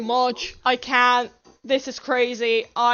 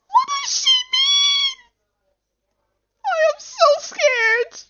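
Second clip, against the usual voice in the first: very high, loud panicked squeak; scared squeal; 6 dB louder than usual. Real, not acted. Player unnerved by her meaning, scared squeal. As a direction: squeal it at the top of the voice.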